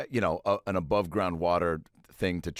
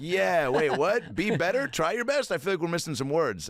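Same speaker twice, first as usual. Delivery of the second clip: confused crowd; 8 semitones higher than usual. Retold or imitated. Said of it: "everyone's like".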